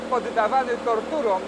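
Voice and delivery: ordinary delivery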